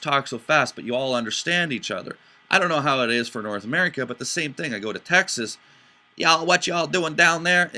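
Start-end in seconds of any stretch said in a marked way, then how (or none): 6.2-7.7 slow, loud Texan drawl imitation; mock Southern Texan twang; Texan accent. Mimicking Texans.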